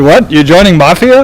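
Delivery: lightly imitated Bulgarian accent, incredulous question; Bulgarian father-in-law. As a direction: mimic a startled father-in-law's Bulgarian accent. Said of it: "he's also from Bulgaria".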